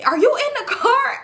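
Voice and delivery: drawn-out, very high call to someone in a car; re-enacted high yell, stretched slow. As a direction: holler it high and long, demanding an answer. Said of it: "And I'm yelling".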